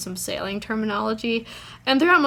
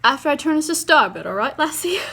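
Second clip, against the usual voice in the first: high, loud, fast mock British captain; captain's order in a playful put-on British accent; 6 semitones higher, 7 dB louder, faster than usual. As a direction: ham up a British sailor calling his crew lassie. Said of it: "I don't know why I'm doing a British accent".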